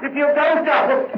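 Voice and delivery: high, frantic pleading; high-pitched, urgent nervous begging; agitated pleading. Acted. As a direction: beg him to leave, panic rising. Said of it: "there's no need to get so excited".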